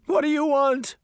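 very high, loud frightened cry; desperate cry. A narrator's reading. Deputy pleading with the Horseman, crying out high. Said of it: "the deputy cried".

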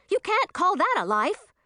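high, indignant cry; cried out. Acted. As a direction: nearly shout it, outraged. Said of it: "cried Zoe".

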